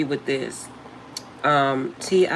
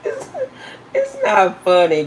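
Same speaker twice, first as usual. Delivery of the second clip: laughing; 4 semitones higher, 5 dB louder than usual. Real, not acted. Laughing while denying it's funny.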